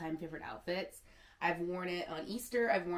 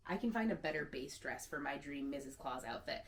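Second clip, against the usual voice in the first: ordinary talk, nothing marked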